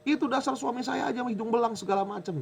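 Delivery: high, startled retort from the wife; shocked wife. Retold or imitated. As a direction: snap back as if caught off guard. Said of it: "Dia kaget".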